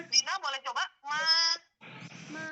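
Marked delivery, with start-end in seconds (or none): none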